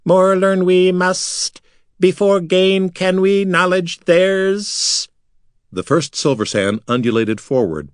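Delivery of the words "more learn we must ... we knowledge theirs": high, slow, strange alien voice; high slow creature voice. A narrator's reading. Silver Sand urging the others on. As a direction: drag each word out high.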